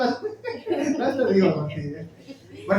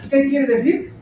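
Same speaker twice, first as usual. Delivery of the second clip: usual speaking voice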